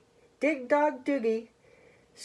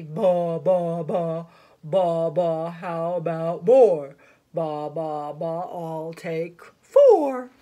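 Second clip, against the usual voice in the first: deep, low bleating goat; low billy goat character; 9 semitones lower, 4 dB louder, slower than usual. Acted.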